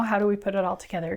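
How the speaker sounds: normal talking voice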